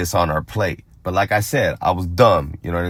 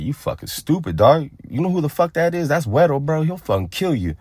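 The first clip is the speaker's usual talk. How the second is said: cousin voiced much higher, panicky disbelief; alarmed cousin; 8 semitones higher than usual. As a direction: play the freaked-out cousin warning him off. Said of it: "My cousin was like".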